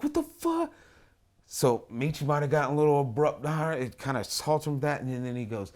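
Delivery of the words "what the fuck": much higher, alarmed; shocked cry. Retold or imitated. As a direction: recoil in shock. Guy pulls pants down.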